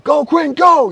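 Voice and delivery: very loud, high-pitched cheer; yelling. Retold or imitated. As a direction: belt it out to a player on the field. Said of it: "and I'm yelling".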